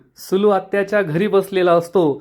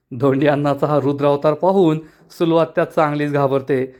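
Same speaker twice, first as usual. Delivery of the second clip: ordinary delivery, nothing marked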